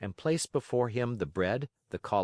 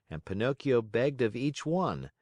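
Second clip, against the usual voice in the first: ordinary delivery, nothing marked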